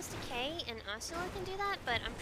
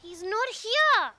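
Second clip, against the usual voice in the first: loud, slow, exasperated yell; frustrated shout; 5 semitones higher, 10 dB louder, much slower than usual. Real, not acted. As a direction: bellow it in disbelief. Arrives, target gone, frustrated.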